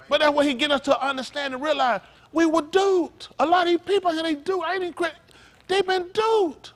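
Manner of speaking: a revival preacher hammering every line; full-force preaching. Acted. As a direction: drive it home to the congregation.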